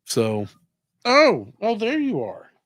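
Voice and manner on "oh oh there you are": pet talk. Real, not acted. Greeting the cat in high voice.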